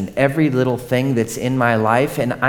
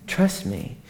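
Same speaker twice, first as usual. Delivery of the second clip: soft high "Trust me"; 7 semitones higher, 7 dB quieter than usual. Acted. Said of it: "repeated with different delivery".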